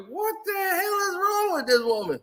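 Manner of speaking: high-pitched exasperated disbelief; high, wailing mock bewilderment. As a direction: act out being appalled, voice jumping way up. Acts out his baffled reaction.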